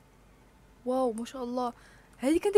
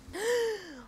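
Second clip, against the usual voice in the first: high, sudden gasp of surprise; sharp surprised gasp; 10 semitones higher, 4 dB quieter than usual. Real, not acted. Gamer seeing a rare pet, gasping. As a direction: gasp at something amazing.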